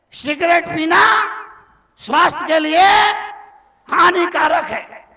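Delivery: loud, high declaiming of the warning; booming proclamation. Acted. Preacher thundering the packet warning to the crowd. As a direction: proclaim it to the whole hall.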